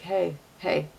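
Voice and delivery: soft, hushed little call for attention; playful half-whisper. Acted. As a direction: lightly imitate a whispered nudge. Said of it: "a little bit of that whisper".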